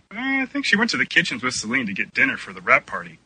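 ordinary talk, nothing marked

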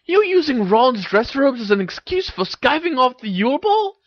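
very high-pitched disbelieving question; can't believe the excuse, voice shooting up; incredulous. A narrator's reading. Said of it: "asked Hermione incredulously".

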